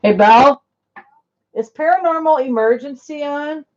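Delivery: called out to another room; calling out. Real, not acted. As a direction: raise it to reach the next room.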